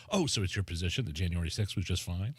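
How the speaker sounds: mock her smug comeback through a laugh; laughing mock impression. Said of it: "how does she know what his position is".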